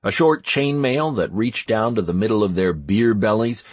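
ordinary delivery

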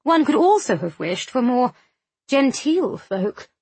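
high, fussy lady, lingering on the last words; genteel snob, slowing to pick her words; disdainful society lady. Acted. Narrator voices Missus Fothergill complaining.

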